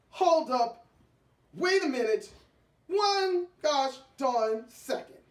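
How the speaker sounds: loud, clipped stop-everything yell; indignant shout. Acted. Wife stopping the story in outrage, loud indignant shout.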